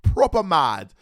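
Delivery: put-on high, drawn-out British accent; mock British accent. Mocking people who are still upset, in a high British accent. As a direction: ham up a British taunt, pitched high.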